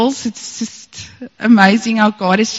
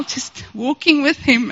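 normal talking voice

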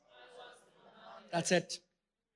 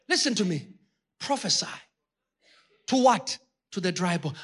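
ordinary talk, nothing marked

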